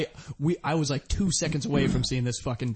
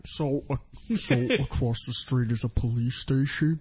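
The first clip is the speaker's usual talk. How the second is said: lightly put-on fat girl voice; a hint of a girly voice, laughing; 3 semitones lower than usual. Shown as a demonstration. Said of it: "this is just my fat girl voice".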